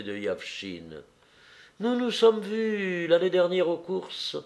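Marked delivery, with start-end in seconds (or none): 1.8-4.4 a higher voice for Anna's social chat; lightly played woman greeting a guest, pitched up; lightly voiced hostess. A narrator's reading. Narrator voices Anna greeting guest. (voix d'hôtesse légèrement jouée, plus aiguë)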